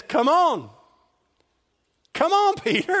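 high, loud beckoning call. Acted. Jesus to Peter in the boat, calling him out. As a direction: urge him over, pitched high and projected.

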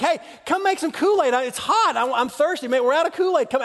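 put-on high, pleading little-kid whine; child begging siblings; whiny kid. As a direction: nag them for Kool-Aid. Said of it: "it's like".

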